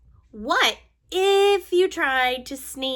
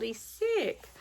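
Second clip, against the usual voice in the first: plain reading voice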